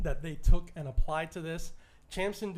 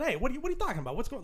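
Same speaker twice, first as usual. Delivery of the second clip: higher-pitched Canadian-Italian family talk; Italian family accent; 8 semitones higher, much faster than usual. Shown as a demonstration. Host showing how heritage slips out with Italian family, Italian accent. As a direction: slip into the Italian accent you use with relatives. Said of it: "my Italian family, sometimes I get a little bit".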